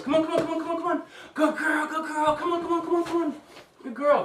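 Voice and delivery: very high, eager puppy call; high-pitched excited voice. Shown as a demonstration. Trainer calls and praises a puppy in a demo, high-pitched excited voice. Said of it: "Call 'em to you in an excited voice".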